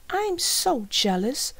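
put-on high, drawn-out girl's voice; slow, high-pitched schoolgirl; jealous girl. A narrator's reading. Girl character voiced in story.

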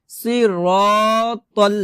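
very slow সিরাতাল with rounded "raw"; drawn-out thick র in সিরাতাল; heavy ra recitation. Shown as a demonstration. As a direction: hold the vowels of সিরাতাল long. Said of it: "সিরা হবে না".

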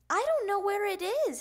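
high, loud complaint; little frog at a loss; childish protest. A narrator's reading. Child protests about lost helmet.

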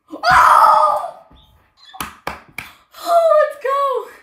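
very high, loud, slow cheer; triumphant shout. Real, not acted. Kid cheering a great pull. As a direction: belt it out in joy. Celebrating topper.

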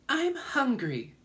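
high, drawn-out spider voice; spider character. A narrator's reading. Spider announces hunger to the others, high sudden announcement. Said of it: "the spider announced suddenly".

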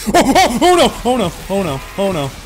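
loud, high real panic; real startled scream of oh no; panicked oh no. Real, not acted. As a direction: yelp it out in sudden fright. Jump scare in horror game.

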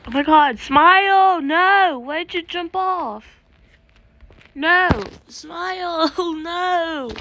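high, loud drawn-out wail; distressed cry. Real, not acted. Player to teammate who jumped off, dismayed wailing. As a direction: cry out as a friend is doomed. Friend Smile jumps off in game.

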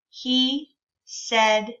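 put-on slow and clear, each word laid out for kids; slow modeled reading. Shown as a demonstration. Teacher modeling a sentence slowly for young readers. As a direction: read it slowly so children can follow. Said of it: "Can you read it with me?".

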